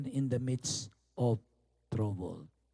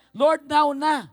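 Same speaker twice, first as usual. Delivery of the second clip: loud, high, insistent plea to God; urgent, pushy praying; impatient prayer; 12 semitones higher, 15 dB louder, much slower than usual. Acted. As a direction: demand it loudly and high, right now. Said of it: "gusto natin".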